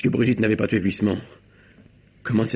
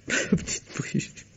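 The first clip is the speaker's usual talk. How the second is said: broken whisper; 6 dB quieter, much slower than usual. Acted. Whispered grief.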